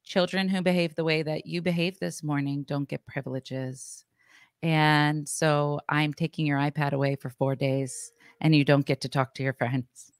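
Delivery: controlled, measured telling-off; very calm. Retold or imitated. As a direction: lay down the punishment calmly and evenly. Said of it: "very calmly I'm like".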